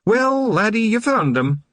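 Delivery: high, teasing Scots brogue; amused Scottish pixie. A narrator's reading. Rob Anybody teasing Wee Mad Arthur, high amused Scots. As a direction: rib him like a cheeky big brother.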